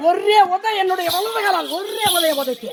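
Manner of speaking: high, emphatic climax of a tale; forceful boast. Acted. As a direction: drive home the single mighty kick. God recounts his mighty kick. (ஆவேசமாக உயர்ந்த குரலில்)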